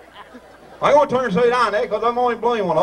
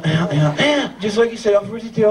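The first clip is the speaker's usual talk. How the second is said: normal talking voice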